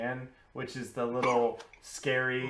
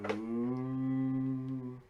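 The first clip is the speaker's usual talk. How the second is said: zombie moan. Acted. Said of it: "They continue to moan and make zombie noises".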